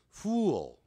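"fool" drawn into "foo-ol", tongue tip up; final L sounded. Shown as a demonstration. Teacher showing students the ending, careful pronunciation. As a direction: glide "fool" into a dark L. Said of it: "舌尖翘起来".

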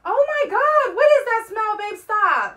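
loud exasperated. Real, not acted. Husband upset at the smell.

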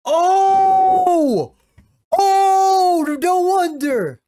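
high, loud shout of shock. Real, not acted. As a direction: yell it out in total disbelief. Reacting shocked to anime reveal.

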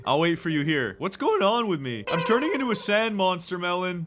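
ordinary talk

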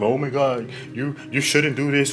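lightly mocking nosy critic voice; a hint of a whiny, disapproving busybody; mocking judgy people. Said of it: "They're not gonna be checking".

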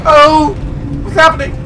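high-pitched frightened squeal; hammed-up fear. Player calls Cosmo for help in the dark, hammed-up squealing fear. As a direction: squeak helplessly for rescue in mock terror. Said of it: "I'm scared".

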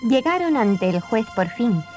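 usual speaking voice

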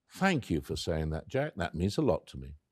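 low, soft, heartfelt; tender thanks. Retold or imitated. Dying wife thanks her friend, tenderly. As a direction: thank him gently and sincerely.

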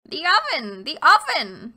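put-on loud, snapping old witch; impatient hag. A narrator's reading. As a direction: bark it out, losing patience. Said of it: "said the hag".

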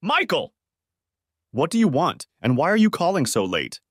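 high, sharp demand. A narrator's reading. Melanie demanding why Michael calls so late, sharp. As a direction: press him for an answer. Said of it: "she demanded".

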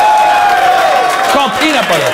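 high, loud and slow, spelling out the obvious; loud, slow exasperation; exasperated. Real, not acted. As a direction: drag out peanut butter in disbelief. Exasperated correction of a rap line.

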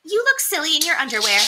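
a lightly voiced mocking wife; wife's voice. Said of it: "his wife only said".

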